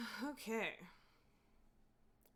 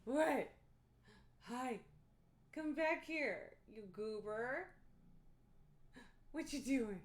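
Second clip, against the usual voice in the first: higher-pitched voice coaxing a cat; calling a pet; 5 semitones higher, slower than usual. Real, not acted. Streamer to the cat that went off, playful coaxing. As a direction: coax a pet back in a lifted voice.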